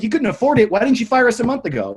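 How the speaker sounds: high, loud incredulous challenge; retold yelling. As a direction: throw it back at the boss in outrage. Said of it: "I just started yelling at her".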